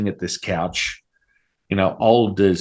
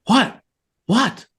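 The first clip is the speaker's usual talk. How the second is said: much higher, loud disbelieving what; shocked what; 4 dB louder than usual. Retold or imitated. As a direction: yelp it in total disbelief. Stunned by friend's scream.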